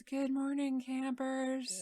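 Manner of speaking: lightly voiced alien possessing a man; an eerie alien speaking through a man. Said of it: "in an alien voice says".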